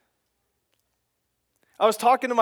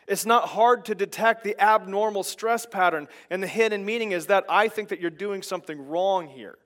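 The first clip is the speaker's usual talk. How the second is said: usual speaking voice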